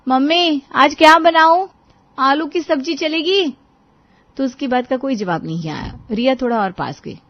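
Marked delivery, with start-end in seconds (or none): 0.1-3.5 loud, high call from the kitchen. A narrator's reading. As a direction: call to someone in another room. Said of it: "रिया ने रसोई से आवाज लगाई". (रसोई से ऊँची आवाज़ में पुकारना)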